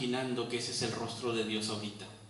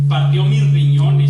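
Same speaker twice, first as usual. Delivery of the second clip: much louder, crying out the words; anguished cry; 17 dB louder than usual. Acted. Preacher crying out Job's anguish to the congregation, loud. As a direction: shout the pain at its peak. Climax of the lament.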